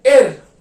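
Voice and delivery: एर said loud and clear; German er. Shown as a demonstration. Teacher demonstrating German letter एर. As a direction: give एर a clear German ring. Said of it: "इसको हम बोलते हैं एर".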